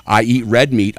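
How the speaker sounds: normal talking voice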